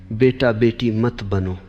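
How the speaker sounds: ordinary delivery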